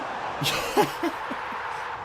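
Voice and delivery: high airy laugh of joy; giddy laugh after a late winner; breathless disbelieving laugh. Real, not acted. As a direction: laugh out the relief. Delirious after late goal.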